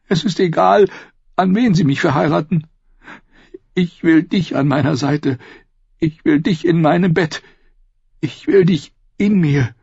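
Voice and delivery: high woman's voice, tearfully pleading; sobbing. A narrator's reading. Sister insists she still wants her brother, tearful. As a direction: plead with a touch of a sob. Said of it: "schluchzte sie".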